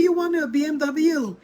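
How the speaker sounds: can't believe what he's asking for; mother answering her son's demand in disbelief; incredulous. Retold or imitated. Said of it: "she said".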